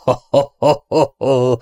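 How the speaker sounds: Ellen scoffs at the plan with a rough laugh. A narrator's reading. Ellen laughs coarsely at Robin's plan. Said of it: "Ellen durván felkacagott".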